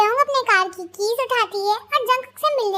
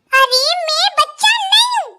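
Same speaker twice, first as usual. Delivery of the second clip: high, loud, drawn-out whine; indignant protest; 5 semitones higher, 5 dB louder than usual. Acted. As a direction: push back hotly, pitched up and slow. Protests at being called a child.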